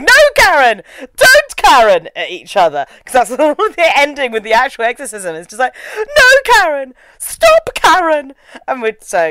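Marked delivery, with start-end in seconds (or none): 0.0-2.1 loud, high melodramatic commands; mock shouting. Host re-enacts shouting with husband, mock dramatic. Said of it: "just started shouting". 6.0-8.3 high, loud melodramatic pleading; mock shouting. Host imitates the exorcism ending, hammed-up shouting. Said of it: "just kept shouting".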